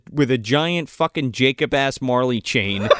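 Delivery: ordinary delivery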